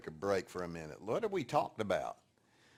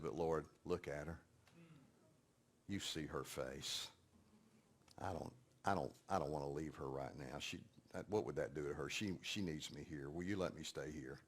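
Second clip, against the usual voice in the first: soft pleading prayer; 8 semitones lower, 10 dB quieter than usual. Retold or imitated. Retold prayer for his life.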